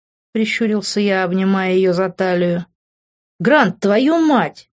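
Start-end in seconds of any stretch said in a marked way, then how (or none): 3.4-4.7 slow, louder, higher indignant cry; indignant outburst. Acted. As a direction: spit out his name in outrage. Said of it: "возмутился Тео". (возмущённо, медленно и громко)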